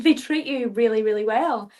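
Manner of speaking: ordinary talk, nothing marked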